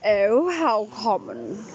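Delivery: slow, drawn-out posh accent; hammed-up posh voice, every vowel stretched; Jeremiah, dead posh. Shown as a demonstration. Showing how the sun god speaks, dead posh. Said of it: "like dead posh, you know, like this".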